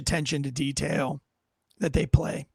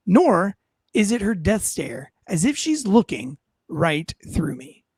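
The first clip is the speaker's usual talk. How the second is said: animated story read with big swooping pitch; measured, expressive storyteller with punched key words; theatrical audiobook narration; 3 semitones higher than usual. Acted. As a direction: perform it as a dramatic first-person audiobook. Reads own audiobook aloud.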